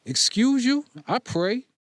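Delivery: offended, fire back; indignant. Acted. Indignant outburst.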